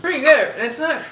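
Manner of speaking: speaking behind hand while eating; chewing while talking. Real, not acted. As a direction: mouth partly full of food. Seen 1: Man chews, hand over mouth; speaker not certain, woman also holds chip.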